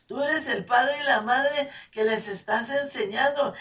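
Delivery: preacher urging parents to be an example; fervent preaching. Real, not acted. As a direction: drive the point home to the parents.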